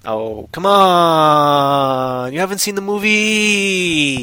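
long drawn-out, rising whine of disbelief; incredulous, drawn-out protest; exasperated disbelief. Real, not acted. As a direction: stretch the words out in playful outrage. Disbelief that listener missed the movie.

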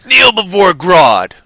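slow, loud villain command; cold rasping evil emperor. Acted. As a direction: drag out each word like a tyrant. Voicing Grodd villain.

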